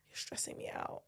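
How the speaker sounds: soft unvoiced whisper of a fed-up toddler; whispered toddler complaint. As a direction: breathe it out like a tiny grump. Toddler son quoted to dad.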